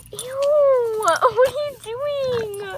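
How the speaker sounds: high loud ew; disgusted squeal. Real, not acted. Girl watching her dog eat something: high disgusted squeal.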